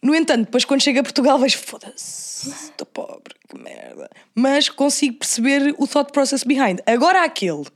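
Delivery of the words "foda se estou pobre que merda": unvoiced, whispered lament; whispered curse. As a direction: mutter it under breath, defeated. Back home broke, lament.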